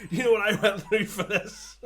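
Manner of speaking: high and loud, laughing through it; mock outrage broken by laughter. Demanding gratitude over cake, laughing. Laughing while acting the scene.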